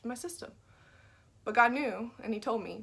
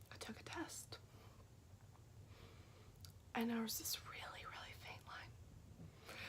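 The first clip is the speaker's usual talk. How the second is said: whisper; 16 dB quieter than usual. Real, not acted. Tense moment, faint test line.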